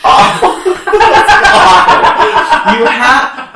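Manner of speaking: real laughter; burst of laughter. Real, not acted. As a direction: break up laughing. Laughing at host clip.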